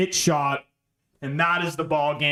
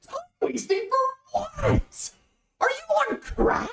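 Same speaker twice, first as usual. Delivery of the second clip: incredulous outrage; slower than usual. Real, not acted. Booking for time wasting.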